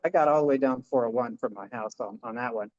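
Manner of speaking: ordinary talk, nothing marked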